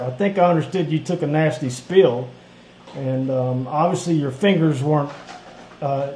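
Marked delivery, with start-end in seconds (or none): none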